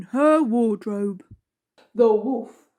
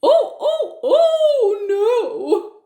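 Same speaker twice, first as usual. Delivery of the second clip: panicking wolf; 10 semitones higher, 3 dB louder, much slower than usual. A narrator's reading.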